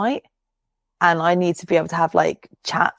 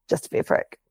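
1 whispered aside; 4 dB quieter than usual. Real, not acted. Muttered aside, no voice.

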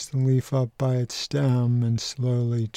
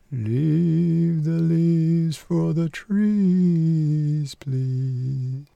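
persimmon tree voice; 5 semitones higher, much slower than usual. Acted. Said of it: "That one there belongs to the persimmon".

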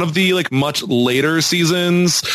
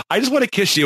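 higher-pitched mock lovesick woman; imitating the woman; 9 semitones higher, much faster than usual. Podcaster re-enacts a woman asking for a kiss, mock pleading. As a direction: lightly act out her plea, pitched up. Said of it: "when she's like".

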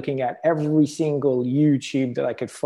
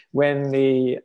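ordinary talk

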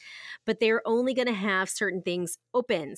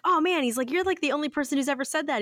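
amazed cast member; 7 semitones higher than usual. Retold or imitated. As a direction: gush like a delighted employee. Cast member voiced in story.

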